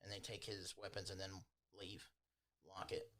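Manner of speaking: ordinary talk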